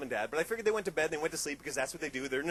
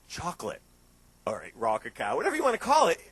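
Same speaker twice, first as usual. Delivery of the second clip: usual speaking voice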